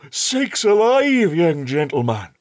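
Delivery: put-on a shocked old shepherd cries out; startled. A narrator's reading. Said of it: "in a startled voice".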